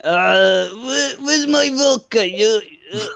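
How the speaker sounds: hammed-up Russian game impression; Nikolai Blensky. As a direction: whine it like a drunk Russian soldier. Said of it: "actor I can do is Nikolai Blensky for Zombies".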